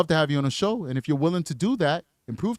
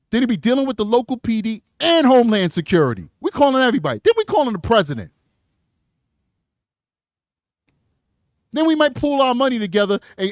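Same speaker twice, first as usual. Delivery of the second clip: mock outrage; 6 semitones higher, 6 dB louder than usual. Real, not acted. Ranting about calling everyone.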